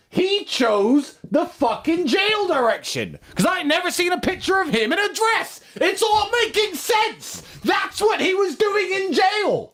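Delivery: rising to a high, shouting rant; excited rant. Real, not acted.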